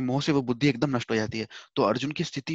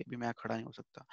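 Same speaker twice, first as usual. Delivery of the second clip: much softer, humble begging; pleading with folded hands; 2 semitones lower, 12 dB quieter than usual. Retold or imitated. Arjun with folded hands begging Krishna, soft and humble. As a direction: quietly beg as if with folded hands. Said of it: "हाथ जोड़ के ही भगवान से ऐसे कह रहा होगा".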